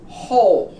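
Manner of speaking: Hole with H first, as in whole; silent W. Shown as a demonstration. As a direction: begin Hole on a plain H. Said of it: "We don't pronounce the W".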